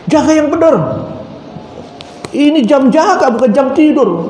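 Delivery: thief scolds the guard, bossy and quick; scolding. Retold or imitated. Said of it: "Malah marah-marah". (omelan tinggi dan keras)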